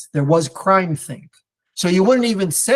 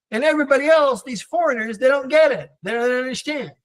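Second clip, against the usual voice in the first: higher, exasperated mock complaint; pitched-up sarcastic whine; smug mock indignation; 8 semitones higher than usual. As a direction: sneer at the foreigners who don't get it. Voicing the teen's smug worldview.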